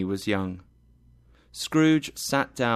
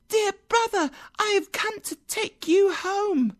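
put-on very high, childlike voice; squeaky small girl bringing glad news; happy little girl. A narrator's reading. Little sister fetches her brother home, happily. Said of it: "said the girl happily".

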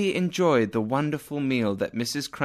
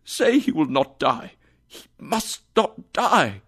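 pleading. Acted. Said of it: "Scrooge said".

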